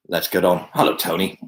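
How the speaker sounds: usual speaking voice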